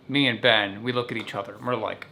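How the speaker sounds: ordinary talk, nothing marked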